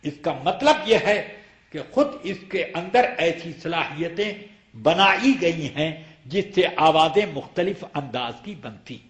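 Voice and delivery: normal talking voice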